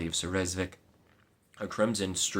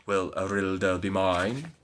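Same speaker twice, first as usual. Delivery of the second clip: slow knight's voice; slower than usual. Acted. Knight voice, lower.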